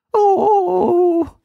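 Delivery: put-on terrified high little-girl wail. A narrator's reading. Frightened girl wailing before the enchanter. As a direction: wail in terror. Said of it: "wailed a little voice".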